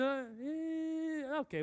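put-on high, unimpressed kid's grunt; a child shrugging it off; bored kid grunt. Son's unimpressed reply.

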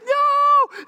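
very high, loud mock wail; imitated child's tantrum. Small son refusing dad at bedtime, imitated tantrum yell.

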